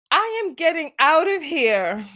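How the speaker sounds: ordinary talk, nothing marked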